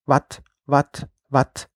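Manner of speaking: "Wat" croaked like a frog. A narrator's reading.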